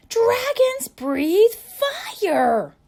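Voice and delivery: slow, very high and loud; stern shout. Acted. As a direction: thunder it out like an upset dad. Father scolding the son.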